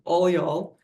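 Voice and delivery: put-on a light Texan accent on all y'all. Joking with the host about Texan talk, in a light Texan accent. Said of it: "I can only think of all y'all at the moment for a Texan".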